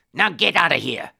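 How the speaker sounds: gruff dismissal. Acted. Guard sends the supposed beggar away, angrily. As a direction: order it harshly in a put-on voice.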